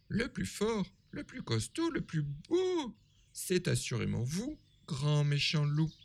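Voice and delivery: very high little pig voices; squeaky small pigs flattering. A narrator's reading. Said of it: "répondent ensemble les trois petits".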